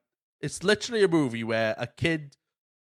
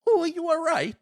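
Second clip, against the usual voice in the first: much higher, fussing mum voice; a nineties mum fussing over her hurt husband; mock worried mum gasp; 4 dB louder than usual. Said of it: "And the mum's like".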